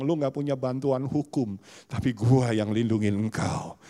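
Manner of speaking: usual speaking voice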